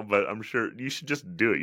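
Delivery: ordinary talk, nothing marked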